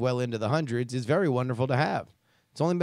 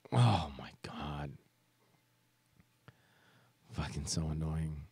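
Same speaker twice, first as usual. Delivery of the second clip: exasperated whisper; 11 semitones lower, 12 dB quieter than usual. Real, not acted. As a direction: breathe it out in disbelief. Exasperated aside after reading.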